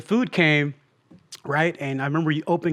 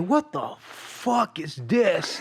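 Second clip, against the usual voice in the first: slow, softened mock disgust; imitating an appalled, angry reaction; retold anger; 5 dB quieter, much slower than usual. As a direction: draw it out slowly, held-in anger. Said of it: "the anger and the emotion".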